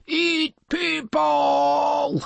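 high, slow dopey monster; drawn-out hungry monster voice; sea monster voice. A narrator's reading. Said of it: "said the sea monster".

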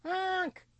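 a soft high goose honk. A narrator's reading. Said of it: "Willow honked".

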